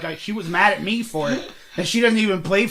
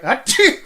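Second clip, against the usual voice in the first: imitated sneeze; 8 semitones higher, 6 dB louder than usual. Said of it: "She would be like".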